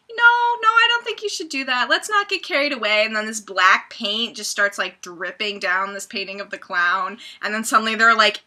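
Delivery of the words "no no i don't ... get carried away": much higher, a bit louder, anxious puppet voice; imitating the notepad puppet's high, anxious protest; nervous notepad puppet. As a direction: squeak it out like a fretful cartoon notebook. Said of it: "the main, like, notepad dude's like".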